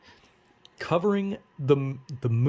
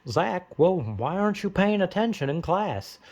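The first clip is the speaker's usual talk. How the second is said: higher, put-on principal voice; silly principal voice; 5 semitones higher than usual. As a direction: play a goofy concerned principal. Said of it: "the principal says".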